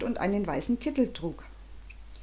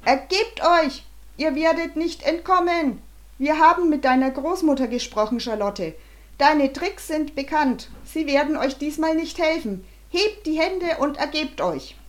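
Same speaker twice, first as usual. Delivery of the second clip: loud, high-pitched commanding call; bellowed megaphone orders; 7 semitones higher, 5 dB louder than usual. A narrator's reading. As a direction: bellow the surrender demand through a megaphone. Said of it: "die Anweisungen des Direktors brüllte".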